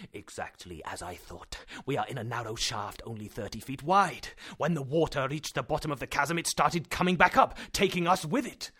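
calm deduction rising into urgency; lecturing uncle. Acted. Uncle explains the rising shaft.

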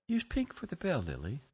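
high-pitched motherly directions; high, fussy mother voice; mother's voice. A narrator's reading. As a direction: instruct a little girl in a high voice. Mother giving directions to child.

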